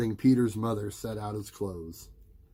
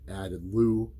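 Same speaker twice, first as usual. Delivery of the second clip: usual speaking voice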